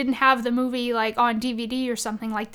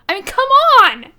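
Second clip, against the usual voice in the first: delighted; 12 semitones higher, 12 dB louder, faster than usual. Real, not acted. Gushing over game line.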